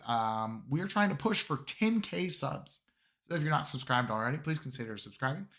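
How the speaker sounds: normal talking voice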